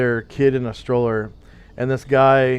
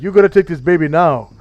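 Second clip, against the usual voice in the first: mock tough guy, raised and loud; thug babysitter; 5 semitones higher, 8 dB louder than usual. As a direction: insist forcefully, imitating a thug. Acted thug insisting.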